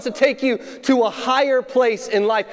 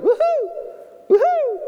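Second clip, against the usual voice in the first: high, happy mock whoops from across the lake; imitating hooting; 13 semitones higher than usual. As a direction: whoop it up like distant fishermen. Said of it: "Hooting and hollering".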